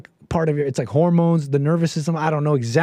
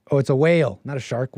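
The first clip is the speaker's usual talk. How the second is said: normal talking voice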